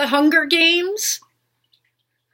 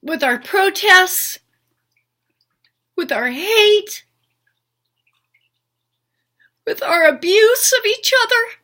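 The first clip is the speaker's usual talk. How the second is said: pleading, rising toward tears; getting emotional; 5 semitones higher than usual. Real, not acted. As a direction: voice climbing higher with real emotion. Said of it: "I'm sorry I'm getting emotional".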